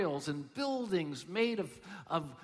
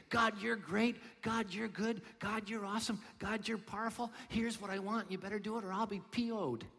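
mock demanding prayer; 5 semitones higher than usual. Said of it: "We normally say".

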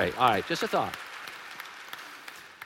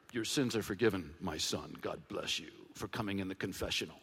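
soft, low, quick mock priest blessing; 12 semitones lower, 11 dB quieter than usual. Pastor parodying a routine priestly absolution, hushed and quick. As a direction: rattle off a hushed confessional blessing.

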